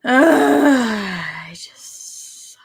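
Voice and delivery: breaking into a tearful whisper; crying whisper. Real, not acted. Fan tearing up alone on stream, whispered sob. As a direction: voice cracking with tears.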